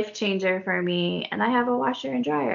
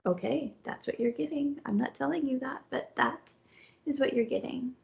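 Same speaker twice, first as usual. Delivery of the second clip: soft, higher private thought voice; inner thought; 5 semitones higher, 8 dB quieter than usual. Retold or imitated. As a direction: say it quietly to yourself, amused. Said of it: "in my head".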